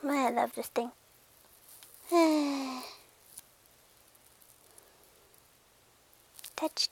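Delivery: near-whispered contentment; very soft, savoring each touch; entranced. Acted. Cat loving the glass it touches, hushed.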